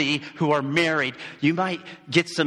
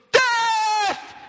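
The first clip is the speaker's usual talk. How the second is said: very high, loud war shout; rally the cavalry with one cry; battle cry; 5 dB louder than usual. Retold or imitated. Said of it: "And he goes".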